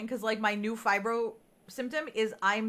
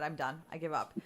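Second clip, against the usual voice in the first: defeated; 6 semitones lower, 7 dB quieter than usual. Retold or imitated. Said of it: "I literally came home and I said".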